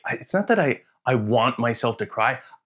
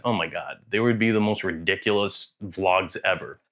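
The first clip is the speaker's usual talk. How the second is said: usual speaking voice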